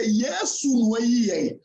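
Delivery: usual speaking voice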